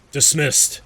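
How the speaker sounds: loud, high shout of dismissal; barked command. Acted. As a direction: bark the order at the troops like a commander. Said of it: "he says".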